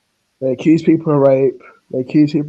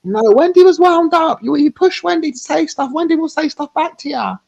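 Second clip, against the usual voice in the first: much higher, louder mocking impression; 14 semitones higher, 4 dB louder than usual. Mocking those who defend Wendy, high mock voice. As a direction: squeak it out like a whiny defender.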